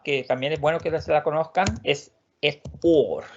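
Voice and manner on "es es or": or said slowly; slow word demo. Shown as a demonstration. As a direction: present the word or. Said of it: "otra palabra también". (or, lento)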